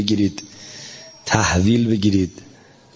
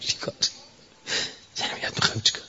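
whispered aside; 10 dB quieter, much faster than usual. Retold or imitated. Imitating dismissive official aside.

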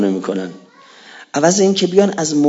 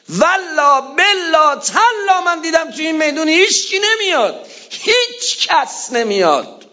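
preaching cry; 10 semitones higher than usual. Real, not acted. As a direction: cry it out high, then slow down. Preacher crying out in lament.

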